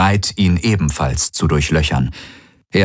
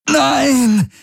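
high piercing shriek, much higher. A narrator's reading. Garlen shrieks under the mind attack, high shriek. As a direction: shriek it out in agony. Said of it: "hörte Kirai Garlens Stimme kreischen".

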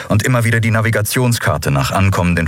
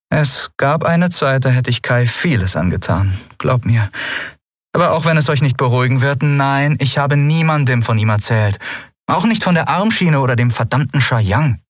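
lightly voiced young woman, higher and mocking; Lien, amused and scoffing; 7 semitones higher than usual. Lien mocks the crew's fear of the cult, amused and higher. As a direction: tease them for their fear, amused.

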